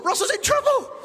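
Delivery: very high squeaky meerkat alarm; meerkat mum. Retold or imitated. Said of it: "my mum's gonna go".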